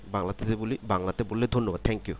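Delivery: normal talking voice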